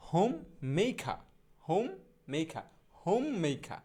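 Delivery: হোম, pause, মেইখা with aspirated "kha" and no r; slow model pronunciation. Shown as a demonstration. Teacher modelling homemaker for learners, হোম মেইখা in slow parts. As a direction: separate হোম and মেইখা, glide "mei", open আ ending. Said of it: "শুদ্ধ উচ্চারণটা হবে এইরকম".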